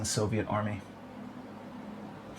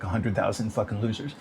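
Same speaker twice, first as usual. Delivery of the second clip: ordinary delivery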